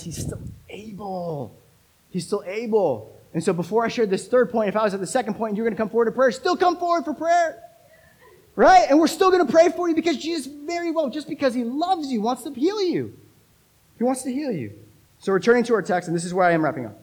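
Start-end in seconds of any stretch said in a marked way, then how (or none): 3.3-14.7 high-pitched, pressing invitation; fervent altar call. Real, not acted. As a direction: urge them forward with fervour. Preaches with force.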